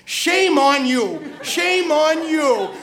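very slow, drawn-out reproach; scolding. Retold or imitated. Elderly lady grabs his arm, slow retold scolding. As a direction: lay the shame on, stretching every word.

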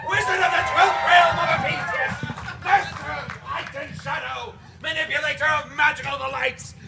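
booming high wizard proclamation; grandiose wizard. Acted. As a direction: proclaim every title like a pompous wizard. Wizard's grand self-introduction.

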